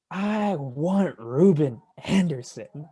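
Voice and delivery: high, slow, theatrical declaiming; emphatic, hammed-up delivery. Parodying the co-host's doomsday punt scenario, emphatic and hammed-up. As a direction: stretch it out like an over-the-top trailer pitch. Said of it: "you gotta say it emphatically".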